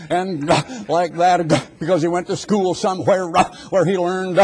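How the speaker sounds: gasping between words to mock a tent evangelist; hammed-up gasps for air between phrases; preacher mocks gasping revival style. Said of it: "going, with every other word".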